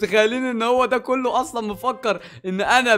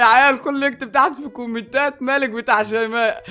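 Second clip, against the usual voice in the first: loud, crowing tease; gleeful taunt; 3 semitones higher, 5 dB louder than usual. Real, not acted. Prankster telling viewers the brother's new nickname, loud gleeful taunt. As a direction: announce the mocking nickname to everyone.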